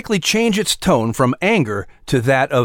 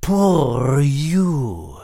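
mocking; 3 semitones higher than usual. A narrator's reading. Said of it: "in a mocking tone".

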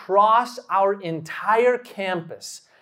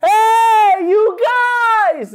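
long high yell, much louder; screamed call; 14 semitones higher, 7 dB louder, much slower than usual. Retold or imitated. Calling after friends about to close the door, screamed out. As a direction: holler it out to stop people leaving. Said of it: "And I screamed out".

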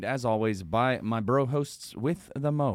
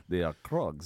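put-on Russian accent; 8 semitones lower, 5 dB quieter than usual. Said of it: "You guys are very good at it".

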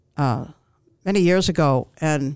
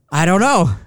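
louder mock-upset reply; retold upset; 6 dB louder than usual. Crying customer answering the cashier, upset. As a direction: blurt it out, replaying your own upset.

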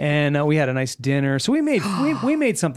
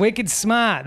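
higher mock Boston, smart as smaht; Boston accent; 7 semitones higher than usual. Host echoes cohost in a playful Boston accent. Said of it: "But a Boston one".

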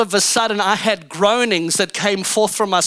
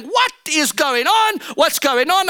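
mock panic, voice raised in pitch; retold alarm; 7 semitones higher than usual. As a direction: act out an alarmed inner voice, pitched high. Retold panicked thought while wailing.

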